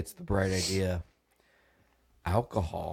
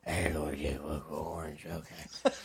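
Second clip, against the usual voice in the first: croaky sick voice; 5 semitones lower, 8 dB quieter, slower than usual. Retold or imitated. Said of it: "I was actually like".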